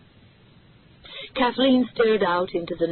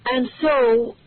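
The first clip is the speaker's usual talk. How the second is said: nervous speaker; 6 semitones higher, 5 dB louder than usual. A narrator's reading. Kathleen speaking to press.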